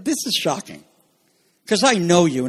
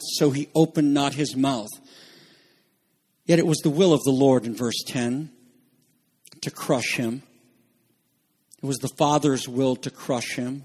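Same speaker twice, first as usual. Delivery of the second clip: ordinary talk, nothing marked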